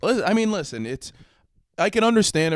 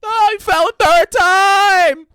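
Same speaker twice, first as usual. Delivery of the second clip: high, loud, slow mock outcry; overacted announcer gasp at the fall; hammed-up cry; 14 dB louder, much slower than usual. Mock Passion play commentary.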